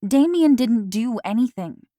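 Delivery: heated defense of Damien; vehement insistence. A narrator's reading. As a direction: insist hard, no room for doubt. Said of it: "I insisted vehemently".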